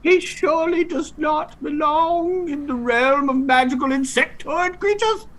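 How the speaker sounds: very high, squeaky fairy creature; high fantasy insect voice. Acted. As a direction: squeak like a tiny winged creature. Eighties fantasy scene.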